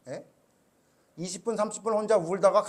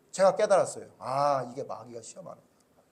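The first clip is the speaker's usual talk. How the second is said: ordinary talk, nothing marked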